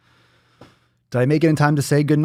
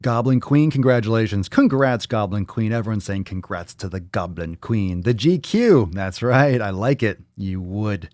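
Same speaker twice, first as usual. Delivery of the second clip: ordinary delivery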